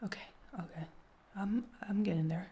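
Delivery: soft whispered okays; breathing calm. Real, not acted. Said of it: "I'm gonna breathe with you".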